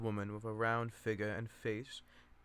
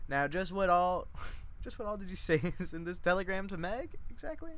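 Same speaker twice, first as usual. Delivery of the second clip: higher, nosy matron pressing for answers; prying cousin Chick; 7 semitones higher, 3 dB louder than usual. Acted. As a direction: needle her with rising suspicion. Chick interrogating Lenny.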